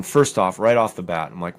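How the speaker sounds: normal talking voice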